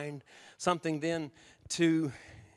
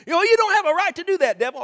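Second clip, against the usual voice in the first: much higher, loud quick retort; defiant shout; 11 semitones higher, 10 dB louder, faster than usual. Retold or imitated. Believer shouting at the devil, high and loud. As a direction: fire it back fast.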